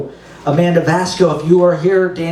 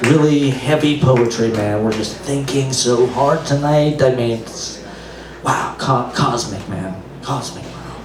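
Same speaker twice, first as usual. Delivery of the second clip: low, dazed stoner drawl; lightly voiced hippie fading to a hush; mock hippie; 7 semitones lower, slower than usual. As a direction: play a spaced-out beatnik, awed. Host mocks hippie poetry fan.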